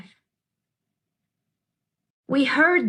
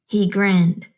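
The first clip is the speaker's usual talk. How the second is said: ordinary delivery, nothing marked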